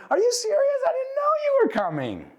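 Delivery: very high, squeaky mock-girly voice; hammed-up, high American girl squeal. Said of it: "one of those American girls".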